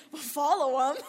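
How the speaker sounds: emphatic, loud mock-friend retort; loud obvious reply. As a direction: belt it out as the friend stating the obvious. Acted friend's blunt reply.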